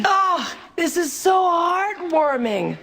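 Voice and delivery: slow, mocking "heartwarming"; sarcastic. Sarcastic jab at family scene.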